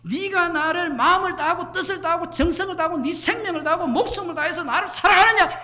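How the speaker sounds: usual speaking voice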